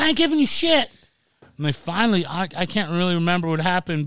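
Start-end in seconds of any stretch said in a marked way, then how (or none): 0.0-0.9 high, loud defiant yell; defiant mock yell. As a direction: shout back defiantly at the cops. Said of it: "I was like".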